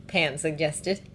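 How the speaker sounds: usual speaking voice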